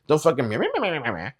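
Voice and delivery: thick-tongued meh noise. Retold or imitated. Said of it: "It was a thick-tongued noise".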